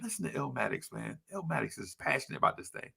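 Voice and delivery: near-whispered praise of a fan; hushed aside. Retold or imitated. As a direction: murmur it fondly to yourself. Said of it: "And I'm just like".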